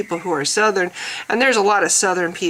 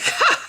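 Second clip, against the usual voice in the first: very high mock "Ha!"; mocking scoff. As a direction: imitate a gloating, high-pitched scoff. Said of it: "this other guy who's like".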